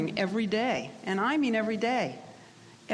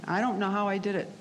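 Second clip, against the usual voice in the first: normal talking voice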